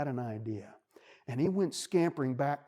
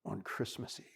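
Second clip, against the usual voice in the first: a hint of a low, gentle wolf; calm and kindly, barely lowered; lightly voiced wolf; 4 semitones lower, 3 dB quieter than usual. Wolf asks the crying bunny, gently. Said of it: "the wolf speaks".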